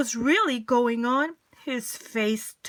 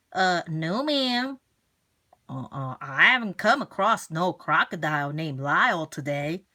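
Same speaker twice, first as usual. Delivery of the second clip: much lower casual clerk; low folksy salesman; lower drawling shop man; 7 semitones lower than usual. Acted. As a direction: shrug it off lazily.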